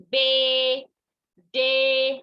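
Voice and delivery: बे and डे held long, loud and level; long A sound. Shown as a demonstration. Teacher drills A-Y words, long loud vowel in बे, डे. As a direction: stretch out the ending of बे, डे. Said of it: "ए वाई भी एक साथ आने से long sound".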